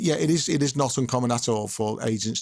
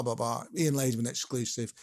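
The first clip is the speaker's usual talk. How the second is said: ordinary talk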